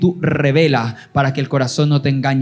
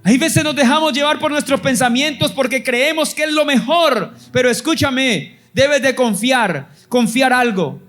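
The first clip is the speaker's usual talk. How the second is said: raised, high-pitched preaching over music; fervent preaching; 9 semitones higher than usual. Acted. Preacher urging his people to trust God, fervent preaching. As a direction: preach it out to the congregation with fire.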